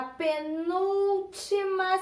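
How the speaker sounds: "penúltima" stretched with a long held NUL; emphatic key term. Shown as a demonstration. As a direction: draw out "penúltima" slowly, syllable by syllable. Teacher stressing the key term.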